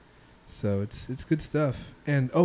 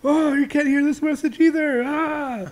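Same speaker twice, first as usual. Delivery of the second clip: very high, louder frustrated cry; frustrated Steve Carell; 14 semitones higher, 5 dB louder than usual. Retold or imitated. Retelling the voicemail scene, very high frustrated squeal. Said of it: "And he's like".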